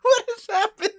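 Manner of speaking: very high laughing disbelief. Real, not acted. Co-host laughing in disbelief at the host's bit. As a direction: ask it through laughter.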